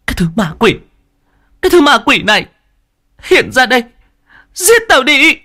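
high, furious scream that builds to a piercing peak; hét lên. A narrator's reading. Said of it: "mà hét lên". (gào thét phẫn uất, thách thức)

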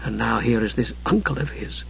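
hushed, troubled aside; sighing. A narrator's reading. Usha alone, fretting over the uncle, sighing softly. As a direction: think it aloud very softly. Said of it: "she sighed".

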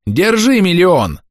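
high, stretched jeer; mocking shout. A narrator's reading. Boy throws a stone as money, mocking shout. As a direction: crow it out high and slowly. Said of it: "кривляясь, прокричал другой".

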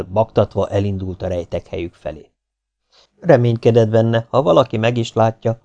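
usual speaking voice

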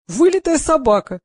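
high, loud and admiring; delighted admiration. A narrator's reading. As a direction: marvel aloud in sheer delight. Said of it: "восхитился Гриша".

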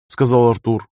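ordinary delivery

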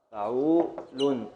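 فعول said slowly, syllable by syllable; slow scansion. Shown as a demonstration. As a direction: tap out the beat of فعول slowly. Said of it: "حركة، سكون".

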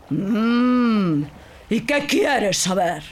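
curious, slow genie voice; a giant genie humouring him slowly. Acted. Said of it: "preguntó el genio". (el genio pregunta con voz lenta)

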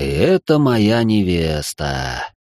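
slow, very low sighing remark; resigned sigh. Acted. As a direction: sigh it out, deep and weary. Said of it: "вздохнул его сиятельство".

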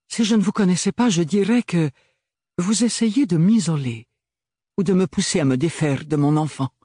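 a poised lady pushing back; wary noblewoman. Acted. Bérénilde accuse Archibald.

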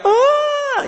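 high sudden cry, mock; retold wail. Preacher imitates worshippers crying out, high wail. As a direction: yelp it like a sob. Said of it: "di pojok sana teriak".

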